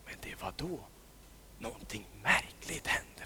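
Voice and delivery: hushed, secret-telling whisper; mysterious whisper. A narrator's reading. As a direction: lean in and whisper the strange part. Suspense turn in the story.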